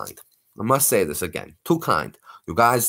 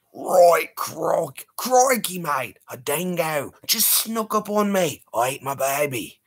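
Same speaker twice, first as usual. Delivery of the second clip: mock Aussie; 6 semitones higher, slower than usual. Crikey mate Aussie bit.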